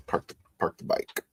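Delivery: ordinary talk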